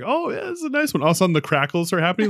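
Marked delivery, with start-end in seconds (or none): 0.0-1.0 high, pleased mock voice; pleased Andy. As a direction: imitate a smug friend admiring a firework. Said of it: "and he's like".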